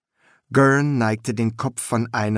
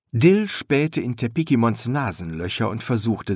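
plain reading voice

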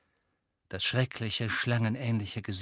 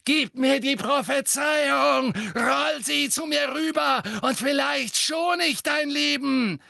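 high, loud commanding yell; screamed demand; 13 semitones higher, 4 dB louder than usual. A narrator's reading. Bellatrix demanding the prophecy from Harry, screaming. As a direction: shout the order across the room. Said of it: "schrie Bellatrix".

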